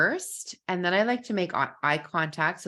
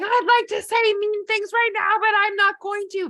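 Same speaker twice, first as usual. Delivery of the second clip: much higher, louder yell; 6 dB louder than usual. Shown as a demonstration. Kid venting anger safely, yelling it out. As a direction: shout it out to release the anger. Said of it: "you're yelling it".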